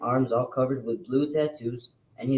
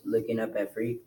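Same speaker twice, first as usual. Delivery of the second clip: ordinary delivery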